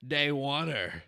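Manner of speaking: loud mock Australian greeting; hammed-up Aussie hello to fans; Australian accent. Podcast host doing an Australian accent for listeners. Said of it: "That's my best attempt at an accent".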